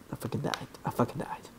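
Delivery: barely voiced, very soft whisper; hushed disbelief. Real, not acted. Player who just died in game, hushed whispering. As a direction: whisper it, deflated. Deflated after dying.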